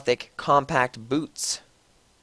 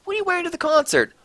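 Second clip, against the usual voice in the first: much higher, fast silly voice; Dumbledore voice; much faster than usual. A narrator's reading. Dumbledore asking Ebony what she'll wear, squeaky and fast. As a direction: squeak it out quickly. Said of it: "he said".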